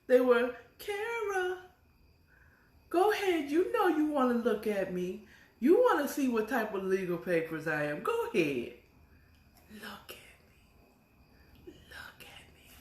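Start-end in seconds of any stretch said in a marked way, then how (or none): 0.8-8.7 high teasing tempting voice; papers calling her. Pretend. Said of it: "the papers was calling her". 9.7-12.8 slow breathy whisper; tempting whisper. Pretend. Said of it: "the papers was calling her".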